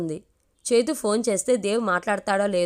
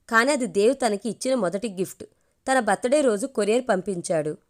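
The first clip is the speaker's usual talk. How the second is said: ordinary delivery, nothing marked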